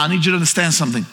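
ordinary talk, nothing marked

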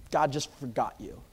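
normal talking voice

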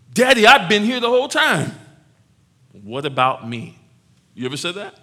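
put-on loud, high, hurt older-brother whine; the older son's outraged where's-mine; aggrieved complaint. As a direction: throw the jealousy at daddy. Older brother protesting to father.

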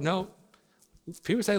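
ordinary talk

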